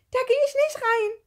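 very high, loud shriek; frightened scream. A narrator's reading. Gerti refuses to go in, high panicked scream. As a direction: scream the refusal at full pitch. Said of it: "Gerti schrie auf".

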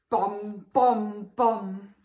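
plain reading voice

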